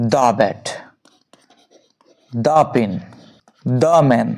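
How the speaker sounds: the said "duh" before bat, pen, man; low-pitched, open vowel in each the. Shown as a demonstration. Teacher drills consonant examples, each the as "duh". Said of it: "তাহলে কি হবে".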